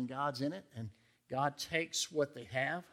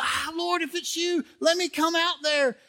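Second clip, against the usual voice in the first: raised, pleading plea to the Lord; eager Peter; 13 semitones higher, 7 dB louder than usual. Retold or imitated. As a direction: ask the Lord high and eager. Peter asks to walk on water.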